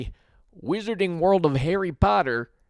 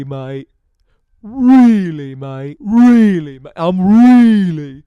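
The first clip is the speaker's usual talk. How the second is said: repeated really swelling into a loud strained cry; building shout; 13 dB louder, slower than usual. Acted. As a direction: wind up from a murmur to a full bellow.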